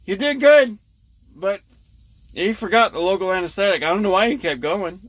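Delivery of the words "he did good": ordinary talk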